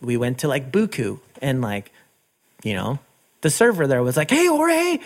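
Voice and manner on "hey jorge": very high, sing-out hello; enthusiastic greeting. Retold or imitated. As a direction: imitate a server greeting a favorite regular. Server's retold delighted greeting.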